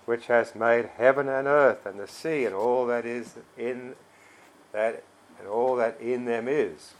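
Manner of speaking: loud, emphatic Bible reading; scripture reading. Acted. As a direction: proclaim the verses with firm, punched key words. Reads scripture aloud to congregation.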